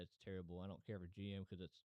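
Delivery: ordinary talk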